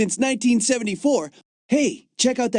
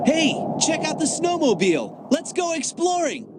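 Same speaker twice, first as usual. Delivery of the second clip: eager excitement; 7 semitones higher than usual. Acted. Young explorer spots a snowmobile and wants to go exploring, excited. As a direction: pitch jumps up with keen enthusiasm.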